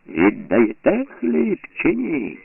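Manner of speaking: high, slow demanding shout. A narrator's reading. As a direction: bark the ultimatum, very slowly. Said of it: "розпорядчик закричав".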